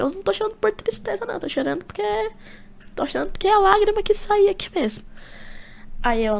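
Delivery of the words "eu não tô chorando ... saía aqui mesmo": high, mock-weepy voice; tearful protest. Son explaining his tears to mother, high mock-crying whine. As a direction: imitate talking through tears, pitched way up.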